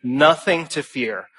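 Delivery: Nothing stressed, slow and falling; measured, deliberate restatement; slow emphatic repeat. Real, not acted. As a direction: lean on Nothing and let it drop. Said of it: "repeats phrase slowly for emphasis".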